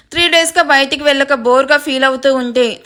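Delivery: ordinary delivery, nothing marked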